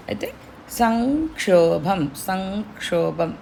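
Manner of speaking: సంక్షోభం slow, the ం as a throaty ఙ; velar ng in sankshobham. Shown as a demonstration. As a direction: sound సంక్షోభం with ng at the back of the throat. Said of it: "ఇంగ్, ఇంగ్ లాగా రావాలి". (సంక్షోభం లో ఙ గొంతు మధ్యలోంచి)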